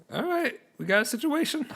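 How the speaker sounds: the eager captain announcing trouble; Captain Willie. Acted. Game master voicing Captain Willie to the party, high officious captain voice. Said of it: "I love the Willie character".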